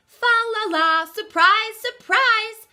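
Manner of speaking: high, loud singsong cheer; gleeful surprise. Acted. Tim surprising his wife with the tree, loud and high.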